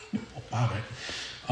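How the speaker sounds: breathy laugh through the words; amused half-laughed aside. Real, not acted. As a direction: laugh it off under your breath. Laughing aside about parents' knowledge.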